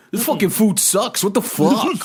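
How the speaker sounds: hammed-up entitled whine; mock tourist complaint. Mocking a tourist complaining about resort food.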